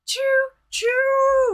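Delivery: high, loud train whistle hoot; choo-choo train noise. Pretend. Streamer celebrating the hype train, imitated choo-choo. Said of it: "I like the choo-choo noises".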